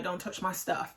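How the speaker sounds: quick, soft quote of "Don't touch my stuff"; understated warning. Retold or imitated. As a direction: toss off the warning quietly, run together. Quoting Harvey's warning, first take.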